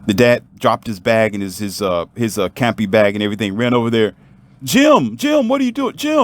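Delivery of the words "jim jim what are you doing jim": high, urgent shout of a name; alarmed calling out. Acted. Dad running to his drowning son, alarmed high call.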